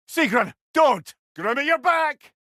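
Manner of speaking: loud, high shouted enemy warning; battle callout. Acted. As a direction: call out the threats over the din. Combat warning.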